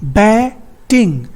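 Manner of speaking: बैटिंग split slowly into बै, then टिंग; syllable by syllable. Shown as a demonstration. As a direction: break बैटिंग before the t sound. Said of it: "ए और ई के बीच में ट आ गया".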